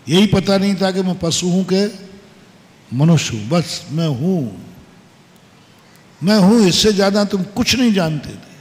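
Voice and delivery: ordinary delivery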